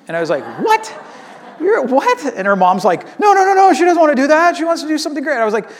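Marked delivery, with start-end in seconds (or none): 0.6-2.2 high-pitched, incredulous retold "what"; shocked disbelief. Pastor reacting to little girl's dishwashing dream, mock shocked. 3.2-5.4 high, loud imitation of a flustered mom; protesting mom. Mom correcting her daughter's answer to pastor, imitated loud protest.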